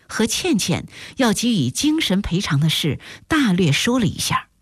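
ordinary delivery

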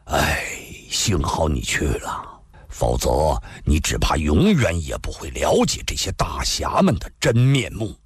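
lightly voiced retainer, low and sighing; a touch of rueful feeling, pitched low; weary older retainer. Tie Chuanjia, glad Ah Fei went, sighs ruefully.

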